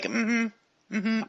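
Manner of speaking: disinterested humming along; bored receptionist. Acted. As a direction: acknowledge someone without caring at all. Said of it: "She looks extremely bored".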